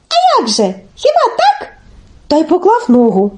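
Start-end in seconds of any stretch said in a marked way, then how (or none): 0.1-1.7 very high little questioning voice; naive Telesyk. Acted. Telesyk checks with Olenka, high and naive. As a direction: ask it innocently, way up high. Telesyk feigns not knowing how.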